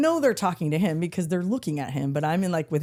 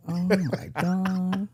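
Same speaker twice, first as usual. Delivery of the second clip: embarrassed dad; much slower than usual. Retold or imitated.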